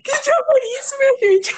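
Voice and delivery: high-pitched, cracked-up exclamation; laughing. Real, not acted. [name] reacting to her friends' teasing, breaking up laughing. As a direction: cry out helplessly through real giggles.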